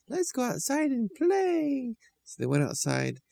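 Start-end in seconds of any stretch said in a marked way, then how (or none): 0.1-1.9 much higher and slow, fond; sweet children's voice. A narrator's reading. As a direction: coo at the puppy like a small child. Children talking to puppy.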